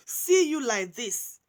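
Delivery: ordinary talk, nothing marked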